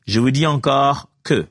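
ordinary talk

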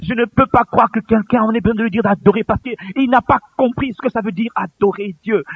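normal talking voice

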